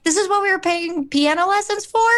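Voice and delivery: high, appalled disbelief, mock dad outrage; dad to son, shocked at what they paid for, appalled. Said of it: "appalled because he's like".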